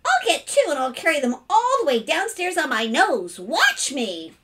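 put-on high, loud boyish enthusiasm; eager showing off. Boy promising his father a nose trick, eager. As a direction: launch excitedly into a new stunt.

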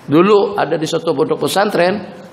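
normal talking voice

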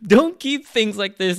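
loud, high-pitched comic outburst; mock angry yell. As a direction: yell it in hammed-up outrage. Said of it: "Sanji is just like".

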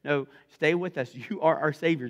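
rapid-fire pleading string of no's; mock panic, begging him to stay; freaking out. Disciples begging Jesus not to leave, acted panic. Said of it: "they start freaking out".

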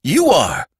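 high, emphatic retort; shouted. A narrator's reading. Sandman telling the boy he is human, shouting. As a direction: throw it back as a shout. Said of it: "he shouted".

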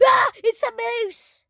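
high-pitched mock shriek; panicked scream. Sheriff finds the mooses not gone, play-acted panicked scream. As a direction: scream it high in pretend fright.

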